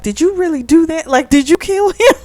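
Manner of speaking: high-pitched, imitated alarmed questions; mild panic. Woman asking him if he killed the man, mildly panicked. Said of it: "she's kinda panicking".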